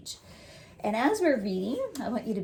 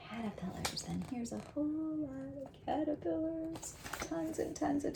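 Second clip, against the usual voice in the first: hushed, higher whisper; whispered wonder; 8 semitones higher, 7 dB quieter than usual. Real, not acted. Teacher whispering about caterpillars while showing the pictures. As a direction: whisper it like a secret discovery.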